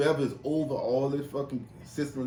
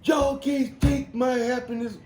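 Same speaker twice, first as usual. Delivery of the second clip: high, slow, loud mock outburst; 6 dB louder, slower than usual. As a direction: wail it like the upset old man. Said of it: "this dude went off".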